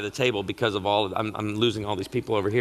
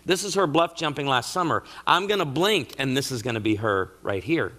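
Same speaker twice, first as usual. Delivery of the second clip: normal talking voice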